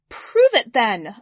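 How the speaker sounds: cried out. Acted. Woman at the back challenges the alien, loud defiant cry. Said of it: "a woman cried out".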